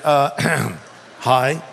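halting, unsure greeting with a throat-clear; hesitate, clear the throat, then answer; awkward hesitant reply. Retold or imitated. Preacher recalls replying to a stranger in the next stall, awkwardly. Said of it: "didn't really know what to do".

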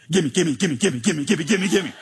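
rapid-fire greedy chant; mock needy prayer. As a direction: parody a grabby, repetitive prayer. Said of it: "let me summarize some of your prayer lives".

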